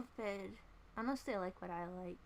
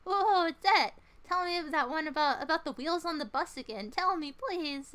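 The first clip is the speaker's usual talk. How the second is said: put-on higher, louder, pleading kid voice; eager kid begging; 6 semitones higher, 8 dB louder than usual. Imagined listener begs the streamer for Wheels on the Bus, childlike and pleading. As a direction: beg like an excited child for a song. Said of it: "You're like".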